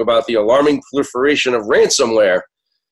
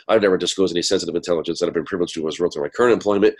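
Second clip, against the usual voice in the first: fast disclaimer read; 4 semitones lower, faster than usual. Acted. Reads the legal disclaimer.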